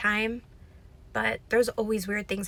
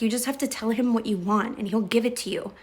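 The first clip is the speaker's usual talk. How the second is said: ordinary talk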